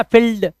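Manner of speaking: फील्ड said short as "fild"; rejected short-i फील्ड; wrong short vowel. Shown as a demonstration. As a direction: keep the i in फील्ड brief. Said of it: "फील्ड मत पढ़ना".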